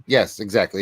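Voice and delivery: ordinary talk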